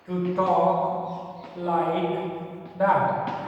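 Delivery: drawn-out words, long gaps; very slow classroom dictation. Shown as a demonstration. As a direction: dictate it slowly, piece by piece. Dictating the second assertive version.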